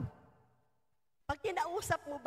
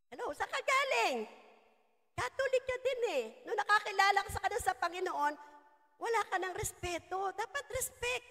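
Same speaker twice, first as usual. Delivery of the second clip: mocking tone; 5 semitones higher than usual. Preacher mocks rude born-again convert.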